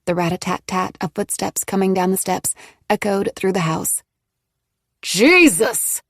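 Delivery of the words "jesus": louder angry outburst; growled. A narrator's reading. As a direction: snap it out in anger. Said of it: "Cam growled".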